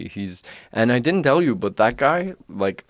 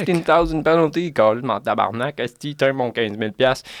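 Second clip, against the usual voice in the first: higher-pitched, swaggering Québécois French swearing; mock Québécois contractor; 3 semitones higher than usual. Contractor brushing off his fine, mock Québécois swagger. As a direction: shrug off the fine in a cocky Quebec accent. Mocking the rich contractor's attitude.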